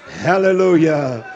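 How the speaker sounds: normal talking voice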